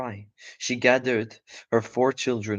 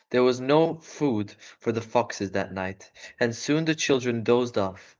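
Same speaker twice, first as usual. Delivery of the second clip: ordinary delivery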